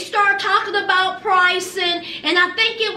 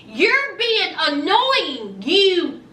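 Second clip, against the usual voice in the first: slow retort; much slower than usual. Retold or imitated. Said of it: "then he says".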